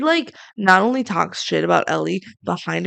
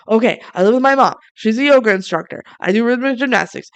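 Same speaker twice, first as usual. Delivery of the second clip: rapid, higher rundown; quick recap; 4 semitones higher, 4 dB louder, faster than usual. Acted. Trading life details after a body swap, quick recap. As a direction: rattle it off briskly, a notch louder. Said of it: "they quick give, like, super recaps".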